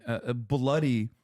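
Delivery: ordinary talk, nothing marked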